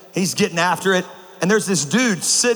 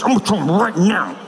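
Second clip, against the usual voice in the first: mom through clenched teeth. Retold or imitated. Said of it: "talk to you through clenched teeth".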